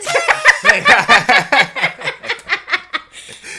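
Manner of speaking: loud, high laughing fit; real loud laugh; high burst of laughter. Real, not acted. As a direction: crack up loudly and high.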